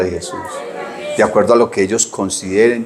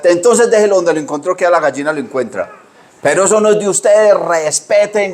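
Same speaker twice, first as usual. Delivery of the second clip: a higher scolding grandma voice; scolding grandmother; 7 semitones higher than usual. Retold or imitated. Grandmother telling the boys to respect what isn't theirs, high scolding tone. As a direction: scold them like a cross grandmother, pitched up.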